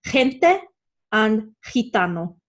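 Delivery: gente and gitano starting with a throaty Spanish H sound; G in gente, gitano said like guttural J. Shown as a demonstration. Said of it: "J and the G guttural sound".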